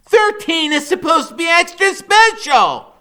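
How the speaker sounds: put-on high, loud boyish complaint; Freak's high whiny voice. A narrator's reading. Boy begging his mother for a big birthday present, high and whiny. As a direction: whine it out like a bratty kid. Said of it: "he says".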